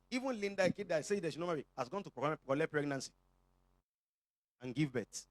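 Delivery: ordinary talk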